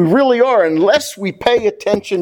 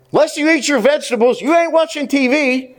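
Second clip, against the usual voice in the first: lightly voiced parent, pitched higher; scolding parent; 7 semitones higher than usual. Parent to child about vegetables and TV, mild mock scolding. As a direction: lay down a house rule in a raised pitch.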